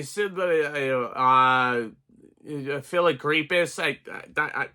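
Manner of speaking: halting, slow stumble through nonsense syllables; fumbled backwards word. Real, not acted. Reviewer attempting supercalifragilisticexpialidocious backwards, stumbling and lost. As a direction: try the word backwards, trail into stammering and give up. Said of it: "you lost me. I couldn't do it".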